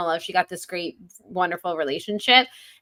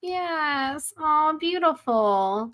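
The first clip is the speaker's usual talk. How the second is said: touched, cooing admiration; 9 semitones higher, much slower than usual. Real, not acted. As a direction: coo slowly, really moved by the beauty. Moved reaction to the passage.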